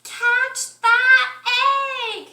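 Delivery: high, loud urgent cry; loud shout. A narrator's reading. Sheep shouts to the others to catch the egg. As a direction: yell it down the hill. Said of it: "Sheep said".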